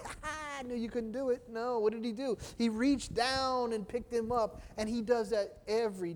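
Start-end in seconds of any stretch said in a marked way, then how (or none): 0.0-1.4 mocking taunt. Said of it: "He didn't let him sink and say".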